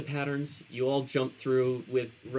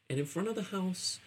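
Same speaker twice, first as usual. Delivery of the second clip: ordinary talk, nothing marked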